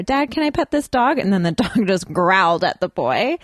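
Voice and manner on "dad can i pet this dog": put-on child asking. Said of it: "the little boy said to his father".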